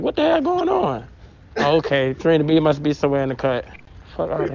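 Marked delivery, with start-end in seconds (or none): 0.0-1.1 surprised. Retold or imitated. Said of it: "I'm like".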